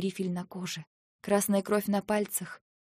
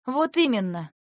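louder, higher curt reply; tossed retort; 5 semitones higher, 7 dB louder than usual. Acted. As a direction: fling it back at him. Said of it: "бросила я". (резко бросила)

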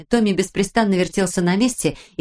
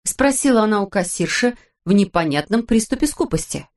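ordinary delivery

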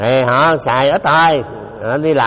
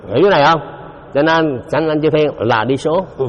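ordinary talk, nothing marked

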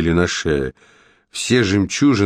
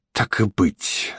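soft, slow reluctant witch; grudging witch; 5 dB quieter, much slower than usual. Acted. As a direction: give in slowly and quietly. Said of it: "согласилась она".

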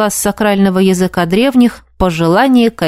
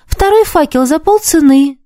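wheedling; 8 semitones higher than usual. A narrator's reading. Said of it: "заискивающим тоном".

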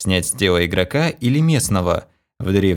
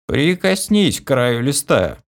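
slow, higher grumpy command; grumbling old man; 6 semitones higher, slower than usual. A narrator's reading. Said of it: "ворчливо велел старик".

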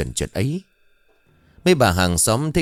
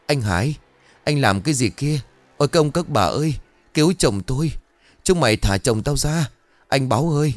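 terrified wailing; 4 semitones higher than usual. Acted. Widow screams to neighbors that her husband is drowning, terrified. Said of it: "la lên những tiếng thất thanh đầy kinh hãi".

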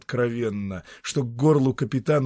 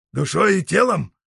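insistent; 8 semitones higher, 7 dB louder than usual. Acted. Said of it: "настойчиво спросил".

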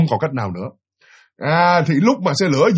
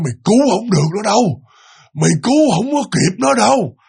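possessed man's menacing line, higher-pitched; gằn giọng; 5 semitones higher than usual. Retold or imitated. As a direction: force out each word as a threat. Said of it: "gằn lên nói như vậy đó". (giọng gằn lên, đe dọa)

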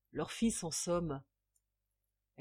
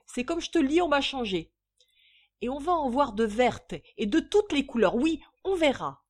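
high, loud, determined declaration; resolute; 7 semitones higher, 9 dB louder than usual. A narrator's reading. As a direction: announce it with firm resolve. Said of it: "après résolu". (résolu, voix forte)

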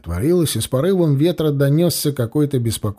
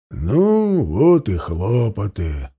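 displeased; 6 semitones lower, slower than usual. A narrator's reading. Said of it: "недовольно сказал помощник пристава".

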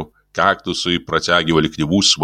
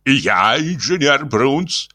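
slow, weighty self-introduction; pompous, drawn-out reply; mock bass; 6 semitones higher, much slower than usual. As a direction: announce yourself slowly with importance. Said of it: "неожиданным басом".

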